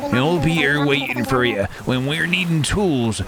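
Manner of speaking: piratical ferryman welcoming passengers; pirate boatman. Acted. Voices pirate boatman reading dialogue.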